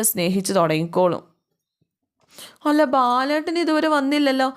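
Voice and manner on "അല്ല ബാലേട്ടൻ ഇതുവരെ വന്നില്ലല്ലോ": high anxious mother's voice, much higher; fretting aloud about a husband not home; worried mother. Acted. Worried mother wondering why her husband hasn't come home, high and anxious.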